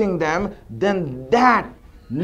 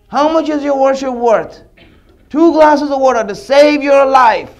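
louder, higher divine rebuke; booming challenge; 5 semitones higher, 5 dB louder than usual. Acted. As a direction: drive the lesson home.

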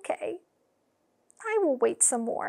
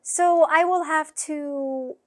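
ordinary delivery, nothing marked

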